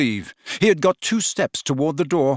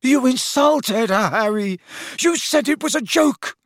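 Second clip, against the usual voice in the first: high, outraged yell; shouting; 11 semitones higher than usual. A narrator's reading. Lovesick lad defending his beloved, shouting. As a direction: shout the accusation indignantly. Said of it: "shouted Ron".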